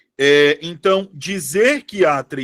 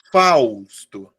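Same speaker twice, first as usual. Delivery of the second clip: "Fausto" with a long, low, weighty first syllable; stress on FAUS; 6 semitones lower, 3 dB louder than usual. Shown as a demonstration. Teacher showing where the stress sits in "Fausto", first syllable stressed. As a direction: lean hard on "Faus", drop "to". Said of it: "A sílaba tônica".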